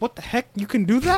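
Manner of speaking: high-pitched imitation of baffled angels; mock astonishment. Voicing all the angels reacting to the block, astonished. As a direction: pitch it up in playful disbelief.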